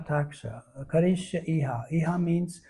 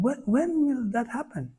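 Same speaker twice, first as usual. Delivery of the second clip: higher-pitched, imploring question; longing plea; 8 semitones higher than usual. Acted. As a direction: plead it, rising high on "when". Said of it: "Lord Shiva is saying".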